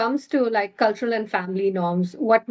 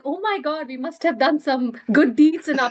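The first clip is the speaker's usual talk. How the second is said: higher, laughing imitation of astonished relatives; amused mock shock; 6 semitones higher than usual. Daughter imitating her mom and grandmom marvelling at the son-in-law, mock shocked. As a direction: retell their amazement, voice lifted and full of laughter. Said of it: "they were absolutely shocked".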